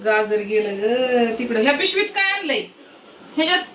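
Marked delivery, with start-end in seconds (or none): 1.6-3.6 very high, playful question. Real, not acted. Mother coos at her baby.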